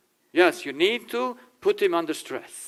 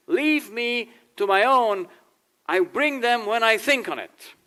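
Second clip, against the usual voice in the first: higher, slow irritated retort; grumpy brush-off; 7 semitones higher than usual. Retold or imitated. Husband snaps back at his wife's reminder, higher and slow. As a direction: push her off, annoyed and pitched up. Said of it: "I said".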